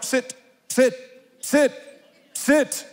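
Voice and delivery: high, firm "sit" like to a dog; God commanding. Pretend. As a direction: order him down, one word at a time. God's hand pushing him down.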